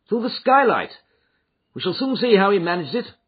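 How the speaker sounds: urgent, alarmed Holmes. Acted. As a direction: bark out the discovery. Holmes finds room empty.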